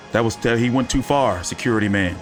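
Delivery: ordinary talk, nothing marked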